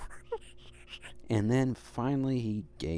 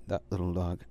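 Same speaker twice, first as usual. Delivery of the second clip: ordinary delivery, nothing marked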